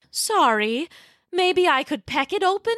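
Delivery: lightly voiced curious pterodactyl mother; a hint of a mother's surprise; Tara, curious. Tara offers.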